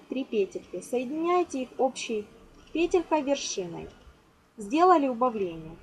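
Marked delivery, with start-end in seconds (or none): none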